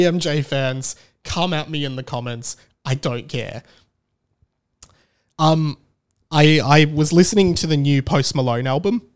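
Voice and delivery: normal talking voice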